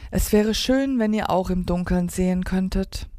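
usual speaking voice

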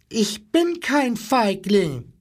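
put-on high, slow little-boy voice; small defiant boy. A narrator's reading. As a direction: protest bravely as a little boy. Small boy faces the animals.

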